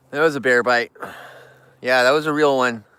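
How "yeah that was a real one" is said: normal talking voice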